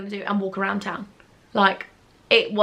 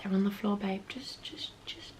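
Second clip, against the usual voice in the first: soft unvoiced whisper; telling boyfriend where the boobs are, whispered; 12 dB quieter than usual. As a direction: whisper it sheepishly. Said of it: "I was like".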